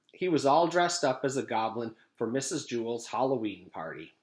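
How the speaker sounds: ordinary delivery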